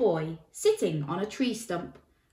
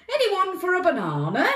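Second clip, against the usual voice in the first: high, loud, inviting call; cheery elephant; 11 semitones higher, 6 dB louder than usual. A narrator's reading. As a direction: offer it round as a jolly elephant. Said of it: "asked the elephant".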